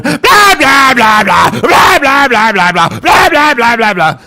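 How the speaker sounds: high, loud mocking blah-blah shout; loud blabbering guy. Mocking the loud guy heard while making coffee, high shouted blah. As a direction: bellow nonsense like a loud neighbor.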